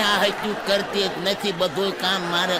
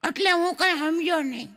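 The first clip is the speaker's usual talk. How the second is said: put-on child voice; 7 semitones higher than usual. Son answers father.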